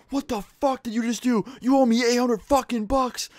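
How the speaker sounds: very high, strained scream held at talking volume; mock screaming. Student whose phone was smashed at teacher, mock screaming. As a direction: imitate outraged screaming without full volume. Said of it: "Like, just screaming".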